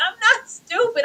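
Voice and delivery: high voice cracking into crying; a daughter repeating herself on the edge of crying; tearful daughter. Retold or imitated. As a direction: let it break into tears.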